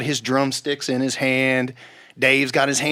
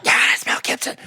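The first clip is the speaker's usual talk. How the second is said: forceful voiceless whisper; exaggerated stage whisper; faster than usual. Two friends a hundred feet apart, excited whispered confirmation. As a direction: imitate mouthing big words across a long distance. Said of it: "back and forth for a hundred feet".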